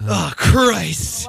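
drawn-out put-on exclamation; lightly voiced dopey recruit; Vincent D'Onofrio as Gomer Pyle. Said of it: "Vincent D'Onofrio play Gomer Pyle".

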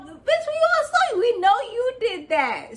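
high, loud mock outrage; exasperated call-out. Vlogger confronting the one who throws rocks then hides her hand, exasperated. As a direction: pitch shoots up, loudly accusing.

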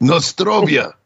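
lightly put-on Russian toast; a hint of a mock Russian accent raising a glass. Cohost toasting the host in a mild mock Russian accent. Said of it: "Russian accent".

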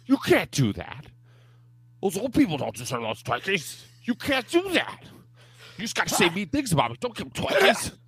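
hammed-up complaint from the rival candidate, rising to a squeal; whiny rival candidate. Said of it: "the other candidate's like".

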